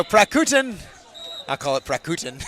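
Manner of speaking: Prakutin said flatly in an American accent; an American mangling Prakutin. Shown as a demonstration. Commentator saying Prakutin in his own American accent. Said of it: "like an American trying to pronounce".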